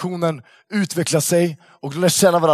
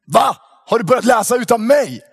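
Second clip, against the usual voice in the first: high, louder indignant surprise; surprised shout; 7 semitones higher, 5 dB louder than usual. Retold or imitated. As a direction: call out, caught off guard and offended. Klara catches him reading.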